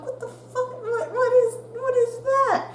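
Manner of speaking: rising panic at something in the mouth; panicked confusion. Retold or imitated. Student finding loose tooth in exam, panicked. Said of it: "I'm like".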